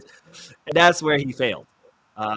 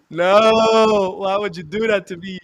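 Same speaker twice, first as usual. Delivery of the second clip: louder, pained protest; mock despair; 4 semitones higher, 6 dB louder, slower than usual. Real, not acted. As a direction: gespeelde wanhoop, luider. Reacting to manager choice.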